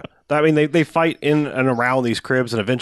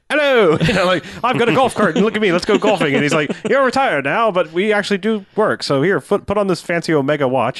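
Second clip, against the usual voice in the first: cheery host voice; 7 semitones higher than usual. Retold or imitated. Voicing cheery Belloc, much higher.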